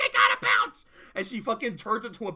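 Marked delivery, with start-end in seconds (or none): 0.0-0.8 Pinkie voice. Retold or imitated.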